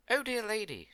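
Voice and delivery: high, loud exclamation; eager appeal. A narrator's reading. Queen calling to the old woman, eagerly appealing. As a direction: call out to her in hopeful appeal.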